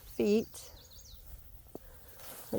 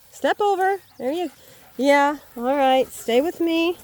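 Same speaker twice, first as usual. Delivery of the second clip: high, raised voice guiding a dog; calling dog along; 7 semitones higher, 5 dB louder than usual. Real, not acted. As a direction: coax the dog over, loudly. Coaxing dog over the fence.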